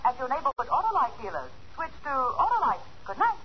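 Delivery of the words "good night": ordinary talk, nothing marked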